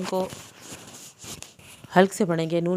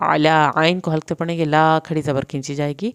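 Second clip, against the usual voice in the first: ordinary talk, nothing marked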